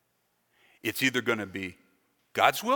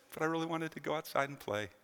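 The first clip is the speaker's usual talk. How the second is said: soft, let-down mock complaint; small disappointed protest; 9 dB quieter than usual. Rained-out player answering the cheery verse, mock disappointed. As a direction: drop the volume for a sulky reply.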